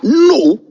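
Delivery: high, forceful NO; emphatic refusal. Real, not acted. Rejecting insult to people's intelligence.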